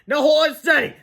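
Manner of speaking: high, loud urgent order; yelled instruction. A narrator's reading. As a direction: shout directions across the air. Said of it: "yelled Fluffy".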